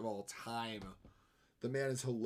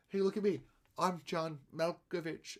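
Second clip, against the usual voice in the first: mock celebrity voice, pitched up; John Malkovich; 5 semitones higher than usual. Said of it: "Bill Hader is with John".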